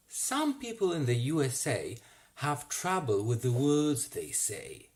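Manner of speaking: ordinary delivery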